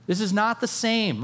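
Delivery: ordinary talk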